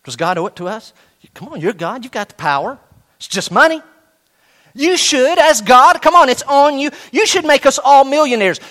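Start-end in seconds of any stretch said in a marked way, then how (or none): 3.2-8.6 higher, insistent pushy tone; mock demand. Imagined listener demanding God make them rich, pushy. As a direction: nag God like a spoiled kid.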